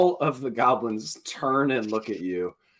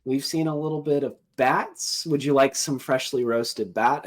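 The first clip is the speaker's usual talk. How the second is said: lightly voiced goblin, a little high; 5 semitones higher than usual. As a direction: offer it as a chatty goblin. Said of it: "one of them goes".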